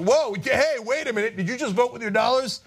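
high-pitched startled double take; mock alarm. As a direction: play a politician suddenly caught off guard. Acts out startled Republicans.

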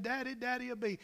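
urgent, high cry to Daddy; imitated child's screaming. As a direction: voice a frightened little girl lightly. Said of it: "she's screaming".

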